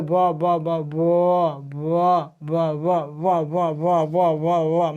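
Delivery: ब drawn out alone, over and over, formed on the lips; lip sound demo. Shown as a demonstration. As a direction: show ब as a repeated, isolated lip sound. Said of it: "माने ओठ पर".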